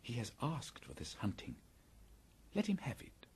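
a grim whispered verdict. A narrator's reading. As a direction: hush it so only Kaa hears. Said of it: "he whispered".

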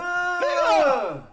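loud sudden outcry; shocked shout. Real, not acted. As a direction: blurt it out loud.

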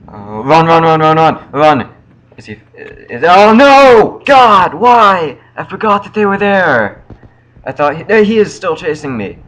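high panicked screaming; being chased. Real, not acted. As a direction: yell in terror while fleeing. Chased by monster, yelling.

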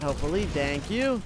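ordinary delivery

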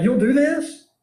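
hammed-up parent threatening a slap; mock threat. Teacher voices parental threat.

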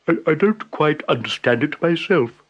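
unsure stammer in a buffalo voice; hesitant buffalo. A narrator's reading. Buffalo unsure, answering Tiger with a stammer.

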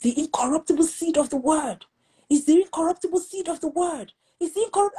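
high, ringing proclamation of the word; retold preaching shout. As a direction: preach it out like a husband declaring scripture. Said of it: "My husband was shouting".

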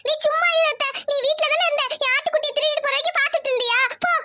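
put-on high-pitched accusing child; upset little girl. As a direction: pitch it up like a child blaming an adult. Child Isai accuses her aunt. (கோபமான சிறுமியின் உயர்ந்த குரல்)

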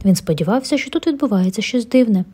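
ordinary delivery, nothing marked

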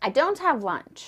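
ordinary talk

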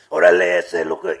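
low, loud street-gang voice; loud Chicano gang talk, voice dropped low; Chicano gang slang. Retold or imitated. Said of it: "he reverted to his gang talking".

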